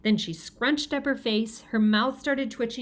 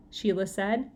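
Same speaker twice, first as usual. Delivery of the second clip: plain reading voice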